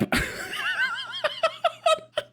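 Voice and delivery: long, high cackle; laughing. Real, not acted. Streamer laughing at his kneecap quip, long high cackle. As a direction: burst into high, helpless laughter.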